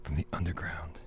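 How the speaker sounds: low, soft show intro; soothing. Acted. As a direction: lull the listeners, sinking gradually into a whisper. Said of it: "Wow, it's so soothing".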